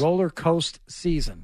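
coaster said as "coast"; mocking mispronunciation. Co-host still teasing the host, coaster cut to "coast". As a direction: repeat roller coaster season, dropping coaster's final -er. Co-host repeats the host's slip.

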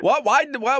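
usual speaking voice

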